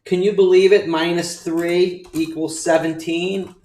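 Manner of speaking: high, loud, fast gloating; excited shout. Acted. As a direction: yell it like a champ. Shouted self-congratulation.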